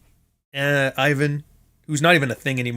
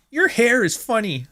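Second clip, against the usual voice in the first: put-on bratty kid voice; 9 semitones higher than usual. Kid roasting you out of nowhere.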